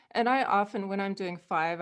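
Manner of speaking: ordinary talk, nothing marked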